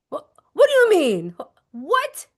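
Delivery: high, loud what; audacious what; mock outrage. Shown as a demonstration. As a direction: play offended disbelief. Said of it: "Cue in your audacity".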